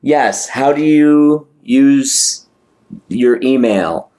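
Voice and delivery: slow, spelled-out asking; slow deliberate question. Real, not acted. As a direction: ask it slowly, word by word.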